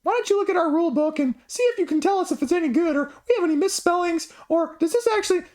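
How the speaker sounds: very high, squeaky cartoon-like put-on voice; high-pitched mocking imitation; mock company voice. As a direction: mimic them in a silly falsetto, rattling it off. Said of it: "And they're like".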